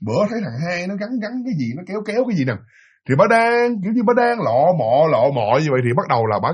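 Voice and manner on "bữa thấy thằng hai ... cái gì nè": soft, fast muttering, half under the breath; muttering to herself. Retold or imitated. Old woman stuck on a bike chain, mutters softly to herself. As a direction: puzzle it out quietly to yourself. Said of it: "nói".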